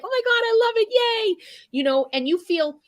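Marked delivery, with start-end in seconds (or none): 0.0-1.5 much higher, thrilled squeal of delight; gushing fan. Retold or imitated. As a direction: gush like a fan who loves the commercial. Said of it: "And you're like".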